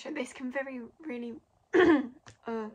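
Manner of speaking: hoarse, voice giving out; throat catching, clearing it; losing voice. Real, not acted. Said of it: "losing my voice".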